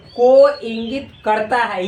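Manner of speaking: slow, deliberate teacher dictation; each word of को इंगित करता है set apart, slow. Shown as a demonstration. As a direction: spell it out slowly for students to write. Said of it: "क्या?".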